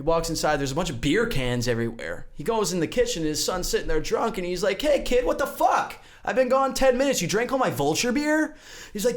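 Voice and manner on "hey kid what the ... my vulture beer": high-pitched, mildly exasperated scolding; acted-out dad's outrage, pitched up; indignant dad. Vulture dad finds his son drunk on his beer, indignant.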